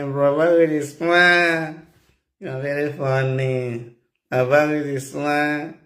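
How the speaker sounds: slow, teasing reply in a woman's voice; amused woman's reply. Retold or imitated. Woman laughing off his advances, slow teasing voice. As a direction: tease him slowly as the woman.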